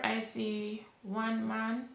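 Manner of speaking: usual speaking voice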